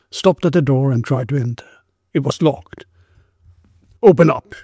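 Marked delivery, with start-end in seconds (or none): 4.0-4.6 raised, louder policeman's call; shout. A narrator's reading. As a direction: call out higher and louder, as an order. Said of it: "he shouted".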